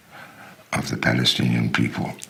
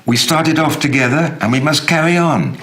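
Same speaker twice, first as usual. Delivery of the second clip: high, louder, pressing voice of the friend; a friend pleading at the airport, higher and louder; urgent friend's voice; 10 semitones higher, 4 dB louder than usual. Retold or imitated. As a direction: urge him on before the plane leaves. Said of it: "I hear you saying that to me".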